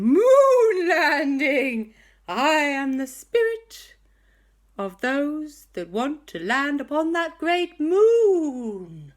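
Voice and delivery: slow, high, loud, solemn spirit voice; grand ghost. Acted. The ghost proclaiming who he is to the boys, slow and grand.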